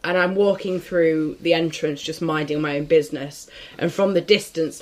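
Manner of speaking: ordinary talk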